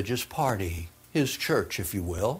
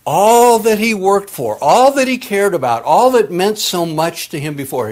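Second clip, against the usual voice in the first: preaching at full force; 8 semitones higher, 8 dB louder than usual. Acted. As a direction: preach it out, rising and loud.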